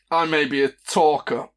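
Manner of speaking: slow, deliberate insults; vicious mockery. Bard casting a mockery spell on a stranger, slow scornful taunt. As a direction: draw out each insult with theatrical scorn. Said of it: "the vicious mockery cantrip on him".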